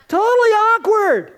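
high, loud totally awkward; preacher stressing how awkward the scene was; exaggerated awkward. Real, not acted. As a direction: blurt it out, cringing.

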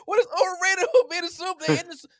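high-pitched, loud disbelief; real, worked-up sports-debate disbelief; incredulous protest. Real, not acted. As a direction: argue back in high-voiced astonishment. Heated incredulous argument over Chiefs.

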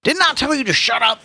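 high, loud angry rebuke. A narrator's reading. Policeman to the old man, angry. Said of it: "the policeman said angrily".

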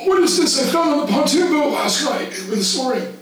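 high, sharp demand, holding up the evidence; accusing director. Retold or imitated. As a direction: shame him publicly, pitch up. Director confronts boy at lineup.

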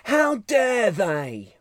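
drawn-out mock outrage in a fake British accent; mock indignant British. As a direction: stretch it out like an offended celebrity. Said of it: "he was like".

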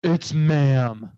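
lightly voiced indignant correction; viral video quote. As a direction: insist on being called ma'am, mock-offended. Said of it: "she is like".

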